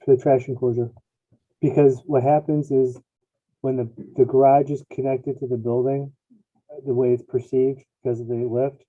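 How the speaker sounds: muffled headset mic; hollow, distant, like in a tunnel; sounds like in a tunnel. Real, not acted. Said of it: "you sound like you're in a tunnel".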